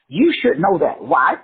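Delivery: usual speaking voice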